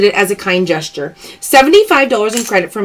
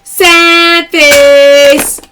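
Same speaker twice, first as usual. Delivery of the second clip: louder, drawn-out call; playful loud callout; loud catchphrase call; 3 semitones higher, 6 dB louder than usual. Real, not acted. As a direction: announce it to the chat. Live sale callout.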